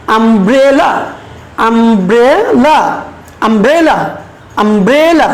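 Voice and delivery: umbrella with the middle syllable stretched and rising; stress on BRE. Shown as a demonstration. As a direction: lean on "bre" in umbrella, then fall away. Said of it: "সেই অংশটুকুতে আমরা জোর দিব".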